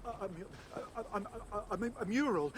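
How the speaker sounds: stumbling, stuttering over the first sound; frightened stammer. Acted. Digger answering the threatening god, a frightened stammer. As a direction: trip over the word out of fear.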